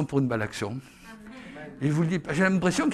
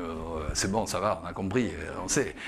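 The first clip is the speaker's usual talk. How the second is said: mock weary dismissal; 9 semitones lower, 7 dB quieter than usual. Mocks annoyed reply to the elder.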